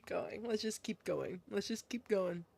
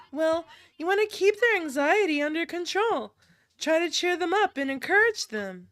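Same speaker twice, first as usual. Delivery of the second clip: higher, perky instructor voice; eager girl character; 3 semitones higher than usual. Acted. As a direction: recite first-aid steps in a higher girlish voice. Same character explains.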